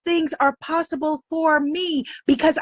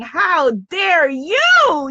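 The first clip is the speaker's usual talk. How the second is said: "How dare you?" higher, louder and slow, in delight; celebrating how dare you; 5 semitones higher, 4 dB louder, much slower than usual. Pretend. Power celebrating the listener's courage, delighted cheer. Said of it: "is saying".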